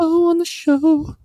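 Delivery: high drawn-out sing-song. Pretend. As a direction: stretch it up high and slow.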